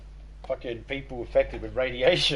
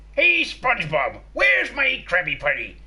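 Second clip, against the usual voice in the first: higher, louder gruff pirate boss; Mr. Krabs voice; 9 semitones higher, 6 dB louder than usual. Retold or imitated. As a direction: bark for your burger like a crusty crab. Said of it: "do a pirate voice".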